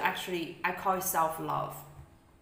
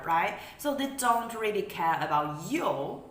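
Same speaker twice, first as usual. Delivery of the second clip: usual speaking voice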